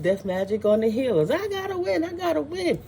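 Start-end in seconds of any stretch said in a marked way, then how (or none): 1.3-2.8 mock desperate rival.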